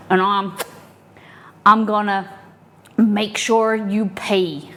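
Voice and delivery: lightly put-on American cowboy accent, swaggering; mock cowboy accent. Customer at the gas shop threatening the clerk, cowboy accent. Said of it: "offended by the accent".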